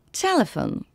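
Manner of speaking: "Telephone" with the weight on TE; stress on first syllable. Shown as a demonstration. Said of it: "L'accent tonique est sur la première". (« Telephone » accentué sur la première syllabe)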